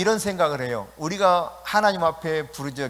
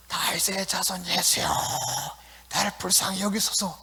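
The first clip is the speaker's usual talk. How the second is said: dramatized Bartimaeus; 3 semitones higher, 4 dB quieter than usual. Acted. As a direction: call it out as if in a play. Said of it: "극을 하듯이 표현한다면, 어, 크게 소리질러".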